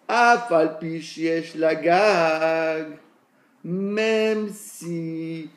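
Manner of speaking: slow chanted reading of the Talmud text. Acted. Teacher reads the Gemara line to the class, slow chant. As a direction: intone the Aramaic words slowly.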